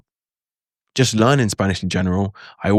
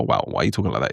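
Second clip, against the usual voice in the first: much lower-pitched, mildly put-off reaction; mock disapproval; 9 semitones lower, 4 dB quieter, faster than usual. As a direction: act out a low, unimpressed "wow". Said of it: "it's in a good way, not in, like, a".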